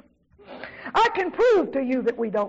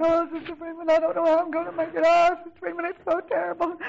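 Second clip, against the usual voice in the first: high, tearful wail in imitation; mock crying; 5 semitones higher than usual. Pastor's wife phoning Sister Freeman about troubles, imitated crying. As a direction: imitate a woman wailing through her words. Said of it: "And she was crying".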